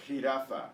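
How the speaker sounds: "Jirafa" as khee-RAH-fah; exaggerated Spanish pronunciation. Shown as a demonstration. Teacher modelling a word to guess, "Jirafa" as khee-RAH-fah. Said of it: "trying to exaggerate a little bit".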